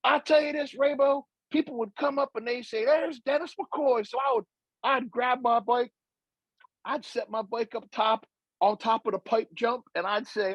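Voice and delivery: high-pitched impression of an excited storyteller; Dennis McCoy. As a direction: imitate a young rider on a rant. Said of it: "he's like".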